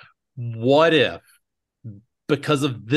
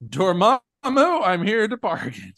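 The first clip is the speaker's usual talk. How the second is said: another take, new inflection; 6 semitones higher than usual. Retold or imitated. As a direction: try the line a new way. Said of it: "Slightly different inflection".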